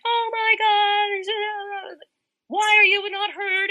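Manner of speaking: high, slow, amazed onlooker voice; shocked bystanders. Retold or imitated. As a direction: gasp it out like a stunned passerby.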